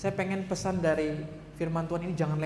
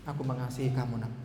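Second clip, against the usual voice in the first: put-on softer, tender words to a child; God's loving voice; 6 semitones lower, 6 dB quieter than usual. God comforting a sinner on Thursday, soft and tender. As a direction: hold the child close with your voice. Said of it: "Di Kamis dia bilang begini".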